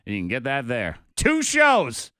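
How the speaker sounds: upbeat on-air host plugging the next segments; radio promo read. Acted. Morning radio host hyping the Facebook Live stream, punchy announcer read.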